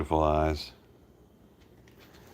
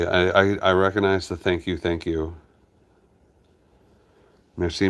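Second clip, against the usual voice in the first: normal talking voice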